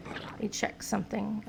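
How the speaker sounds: muttered under the breath; whispered aside. Real, not acted. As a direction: drop to a whisper. Whispered aside while playing.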